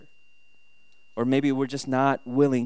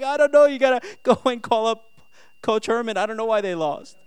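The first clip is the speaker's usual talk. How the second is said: high, exasperated retold reply; acted helpless dad, high voice; flustered dad; 8 semitones higher, 6 dB louder than usual. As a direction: plead ignorance, pitched up. Flustered dad answering upset child.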